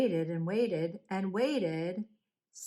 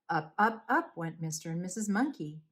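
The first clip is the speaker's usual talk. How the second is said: plain reading voice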